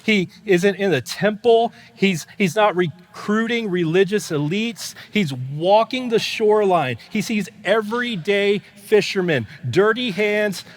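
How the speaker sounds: loud, high, urgent preaching; full-force preaching. Real, not acted. Pastor to congregation about the call of the fishermen, loud preaching. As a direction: drive the passage home to the crowd.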